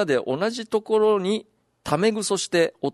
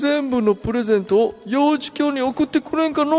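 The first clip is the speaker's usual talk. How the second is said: put-on old man voice; 8 semitones higher, 4 dB louder than usual. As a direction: play a doddering grandpa. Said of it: "かっこおじいさん風で".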